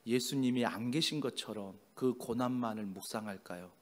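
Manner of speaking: ordinary talk, nothing marked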